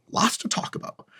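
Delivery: breathy whispered aside; hushed conspiratorial aside. Real, not acted. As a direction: lean in and whisper it. Aside after viewer question.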